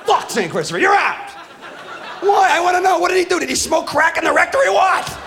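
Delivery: exasperated comic yelling; outraged shout. Acted. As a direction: yell it in disbelief. Outraged rant about saint.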